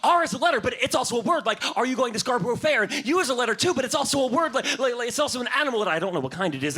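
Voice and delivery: put-on excited kid monologue; distracted kid. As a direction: let the thoughts tumble like a kid. Child's wandering thoughts voiced.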